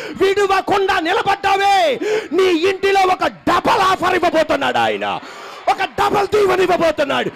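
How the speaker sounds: preacher shouting a double blessing to the congregation; preaching at full force. Acted. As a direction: roar the promise of blessing. Preaching climax.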